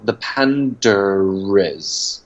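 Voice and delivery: "the panda is" with an R sound linking panda and is, slowly; slow British demo of linking R. Shown as a demonstration. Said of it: "他们会添加一个R的音在之间".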